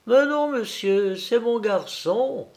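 high, proud mother's correction; maternal pride. A narrator's reading. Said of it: "répondit la femme avec un orgueil maternel". (orgueil maternel, voix haut perchée)

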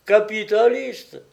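high, loud cries of astonishment; hollering. A narrator's reading. Jean marvels at a capitalist Magouline, hollering. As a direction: holler each word out, punching the first. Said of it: "hurla Jean Travers".

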